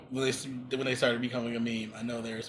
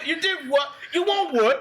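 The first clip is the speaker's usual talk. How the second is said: high hammed-up British voice; laughing friend echoes the gangster, high bad British accent; 12 semitones higher, 4 dB louder than usual. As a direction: toss it back in a mock British accent. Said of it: "bad accents".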